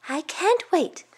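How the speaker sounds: put-on high-pitched child's anticipation; little boy looking forward to a treat; eager young boy. Youngest boy awaiting the rice cake, high and eager.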